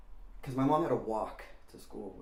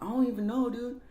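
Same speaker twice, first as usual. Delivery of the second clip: high-pitched acted fretting; worried, exasperated; 11 semitones higher, 4 dB louder than usual. Worried girl answering her older friend, high fretting. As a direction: voice jumps up, imitating a worried kid.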